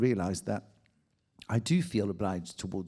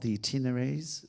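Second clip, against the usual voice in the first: normal talking voice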